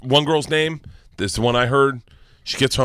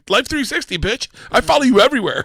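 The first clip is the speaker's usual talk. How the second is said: higher, louder triumphant gloat; higher, louder gotcha boast to the daughter; 13 semitones higher, 5 dB louder than usual. Retold or imitated. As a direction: crow it at her. Dad gloats to daughter about tracking.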